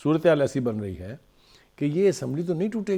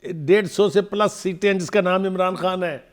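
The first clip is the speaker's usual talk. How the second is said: louder, higher, insistent voice; heated rebuttal; 5 semitones higher, 7 dB louder than usual. Real, not acted. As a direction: cut in forcefully to set them straight. Heated rebuttal over interviewer's talk. (اونچی آواز میں پرزور تردید)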